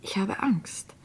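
a hushed, fearful confession; frightened whisper. Acted. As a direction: breathe it out, scared, barely voiced. Frightened admission to the doctor. (ängstlich geflüstert)

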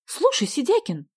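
high, sharp address; sudden flare of temper; exploding. A narrator's reading. Sonya suddenly losing patience with Vasya, exploding. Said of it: "вдруг взорвалась она".

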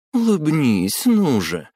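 high, playful purring coax; breathy vintage purr. A narrator's reading. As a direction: purr it at him teasingly. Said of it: "промурлыкала она".

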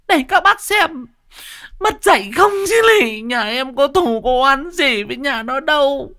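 a wife wailing her grievance to the neighbours. Acted. Vợ Lão Hói uất nghẹn kể lể. (vợ Lão Hói than khóc kể lể)

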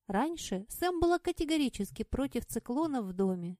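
plain reading voice

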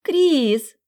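put-on a girl squealing the name in delight; girl spotting Kris at the ball, thin squeal; thin girlish voice. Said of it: "тонюсенький женский голос".

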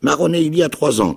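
high, loud cracked castaway voice; a wild islander, half mad and eager; odd castaway. A narrator's reading. Marooned man tells the boy his fate, high and loud. Ben Gunn voice.

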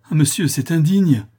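high, loud protest; indignant cry. A narrator's reading. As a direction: cry out in outrage. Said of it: "m'écriai-je".

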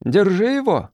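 high urgent cry; shout. A narrator's reading. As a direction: raise the alarm. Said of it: "крикнул кто-то". (крик погони)

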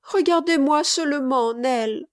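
put-on high, beseeching child's voice; pleading little boy. A narrator's reading. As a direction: coax urgently as a small boy. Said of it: "reprit-il".

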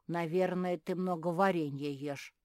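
ordinary delivery, nothing marked

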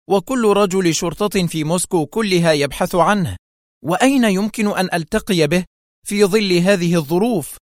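high, agitated protest; exclaiming. A narrator's reading. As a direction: object in incredulous excitement. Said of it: "هتفت". (هتاف معترض بنبرة عالية)

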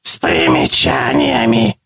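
slow, high hissing monster voice; hissing creature. A narrator's reading. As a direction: hiss it out with smug glee. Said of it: "прошипел голос".

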